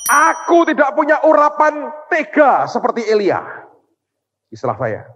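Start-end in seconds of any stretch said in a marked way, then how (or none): 0.1-3.4 high, loud anguished cry, slowed; a heartbroken shout to heaven; crying out to God. Retold or imitated. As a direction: cry out to God, torn inside. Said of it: "Saya jerit".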